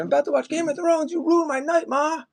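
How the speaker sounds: high mock scream at mom; hammed-up screaming son. Man screams at mom who let cops in, mock scream. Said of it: "the way this guy was screaming".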